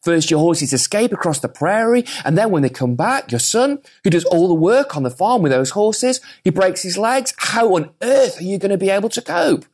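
high, loud fussing neighbours; hammed-up pitying villagers; village folk pity. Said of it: "The village folk saying".

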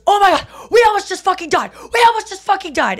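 high loud panicked yell; dad shouting. Retold or imitated. Dad shouting after near crash, high loud yell. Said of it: "And he goes".

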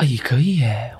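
soft breathy whisper. Real, not acted. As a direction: hush it down to a whisper. Trying soft ASMR-style talk.

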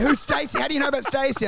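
high, alarmed pretend panic; panicked Rogan. Rogan cornered on his own podcast, panicked high questions. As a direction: blurt it out like a guilty man caught. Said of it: "He's like".

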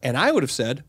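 ordinary talk

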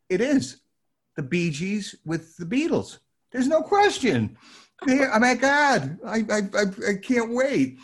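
overjoyed loud high gasp; thrilled. Retold or imitated. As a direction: gush like a fan who just found treasure. Hearing the record, thrilled.

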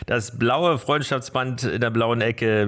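ordinary talk

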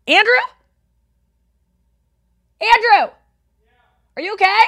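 loud, high call to another room; real worried yell. Real, not acted. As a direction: shout across the house in alarm. Calling to Andrew after crash.

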